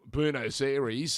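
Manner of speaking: "Buenos Aires" anglicised as BYOO-nos AIR-eez; butchered English reading of "Buenos Aires"; mangled Buenos Aires. Shown as a demonstration. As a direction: say "Buenos Aires" slowly, wrong, rhyming Aires with dairies. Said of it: "abused capital in the world".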